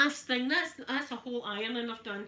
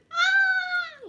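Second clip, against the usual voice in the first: short high shriek, much louder; mock scream; 12 dB louder than usual. Retelling being trapped by cows, she shrieks high and loud. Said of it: "I'm sitting in the car screaming".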